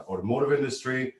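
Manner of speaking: ordinary delivery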